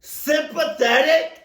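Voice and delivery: high, slow, disbelieving question; can't believe what they heard; incredulous. Real, not acted. Reacting to sympathetic officer.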